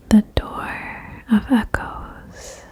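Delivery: ordinary delivery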